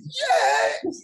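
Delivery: high-pitched exultant yes; mock-celebrate with a high, excited cry; retold cheer. Student cheering over the biology club stipend, high retold cheer.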